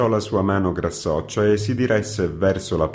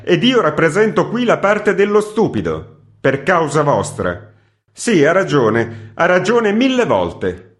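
higher, louder rising indignation; indignant editor; 8 semitones higher, 6 dB louder than usual. Acted. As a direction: protest with wounded pride. (redattore indignato, sempre più acceso)

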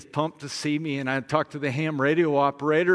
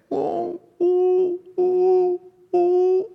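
high, loud sustained howls; imitated dog howl; 7 dB louder, much slower than usual. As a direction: howl like a dog being left behind. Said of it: "began to do this".

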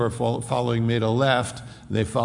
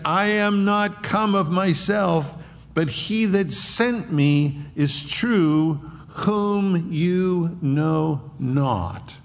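proclaiming; 6 semitones higher, much slower than usual. Acted. Jesus voiced to the crowd.

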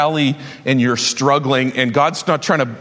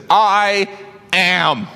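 proclaiming; 5 semitones higher than usual. Acted. Preacher proclaims Jesus's words.

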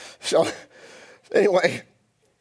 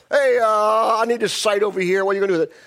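loud, high hammed-up boss; hammed-up shouting for a site; brash big shot; 8 semitones higher, 6 dB louder than usual. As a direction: call out orders like a mock bigwig. Acts out Saul ordering a monument site.

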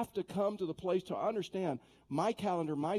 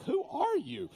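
high, louder startled squeak of disbelief; mock startled; 5 semitones higher, 7 dB louder than usual. Pastor retelling his shocked reply to the trash lady. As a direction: act like the question caught you off guard.

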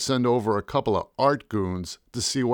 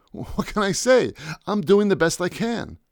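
nervous; 7 semitones higher than usual. Retold or imitated. Narrator nervous under threat.

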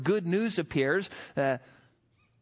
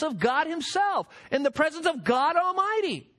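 higher, mocking paraphrase; sarcastic boast; 5 semitones higher than usual. Gabriel rebukes doubting Zacharias with sarcasm. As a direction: sneer at the old man. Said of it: "there's sarcasm here in the text".

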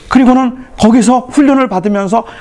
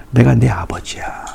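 whisper; 9 semitones lower, 4 dB quieter, slower than usual. Acted. God speaking as father.

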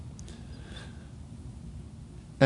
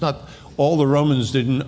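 usual speaking voice

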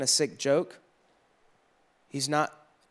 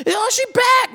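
much higher, louder gushing squeal; excited Snapchat squeal; 8 dB louder, slower than usual. Pretend. Imagined follower snapchatting the healed girl, high excited squeal. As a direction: squeal the news to your followers.

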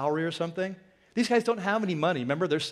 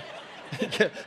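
1 breathy laugh; 4 dB quieter than usual. Real, not acted. Laughs at pastor perk.

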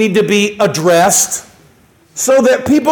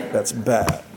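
hushed repeat; 12 semitones lower, 10 dB quieter than usual. Acted. Repeats the phrase, dropping low.

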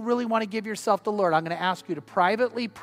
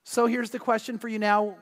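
usual speaking voice

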